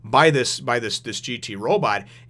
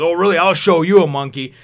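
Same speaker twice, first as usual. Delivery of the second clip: angry threat; 5 semitones higher, 9 dB louder than usual. Retold or imitated. Enraged robot operator answering Toriko.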